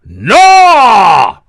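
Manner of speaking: booming, high shout of no; loud, high, drawn-out Ian Paisley bellow. Retold or imitated. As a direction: roar it like a fiery preacher. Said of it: "we could do Paisley impressions all day".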